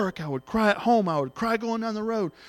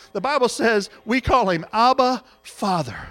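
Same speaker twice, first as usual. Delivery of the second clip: ordinary talk, nothing marked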